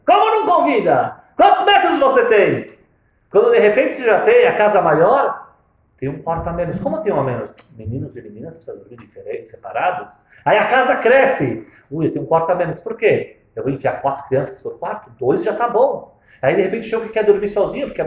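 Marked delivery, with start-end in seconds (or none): 0.1-4.8 much higher and louder, shocked; loud shocked comeback; incredulous outburst. Acted. Acted argument over inviting guests. 10.4-13.4 louder exasperated grumble; exasperated complaint. Acted. Acted complaint about house space.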